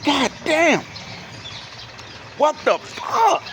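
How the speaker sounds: high, slow exasperated disbelief. Retold or imitated. Narrator complaining to Bunny Young about the attendant, slow high disbelief. His own shocked reaction.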